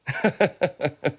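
staccato chuckle at own pun; laughing. Acted. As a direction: laugh at your own joke.

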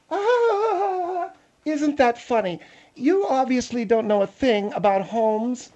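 Margaret. Acted. Margaret scoffing at Phil's taste in homes, condescending. Plays Margaret disputing the house.

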